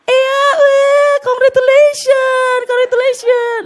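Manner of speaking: a mother celebrating her newborn's first cry; happy mother. Retold or imitated. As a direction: gush like an overjoyed new mom. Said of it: "emaknya seneng gitu".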